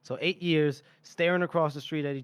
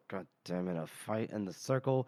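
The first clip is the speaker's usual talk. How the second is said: low, soft gruff muttered grumble; Lamb's low grumble; 7 semitones lower, 8 dB quieter than usual. Retold or imitated. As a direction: growl it low under the breath, a hint of a grumpy old fighter. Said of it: "And Lamb goes".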